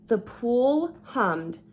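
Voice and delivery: plain reading voice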